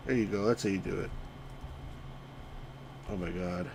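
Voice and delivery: ordinary talk, nothing marked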